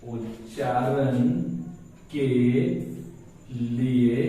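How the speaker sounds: one word at a time, widely spaced; slow dictation. Shown as a demonstration. Teacher repeating a phrase for writers, slow dictation. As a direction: repeat it slowly for someone copying it down. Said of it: "हम लोग लिखते हैं".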